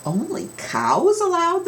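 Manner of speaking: high neighing voice; neighing horses. Acted. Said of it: "the horses neighed".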